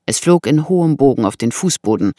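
plain reading voice

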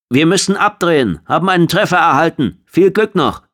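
high, loud, pressed call; urgent radio report. A narrator's reading. Hit shuttle breaking off its support, urgent shouting.

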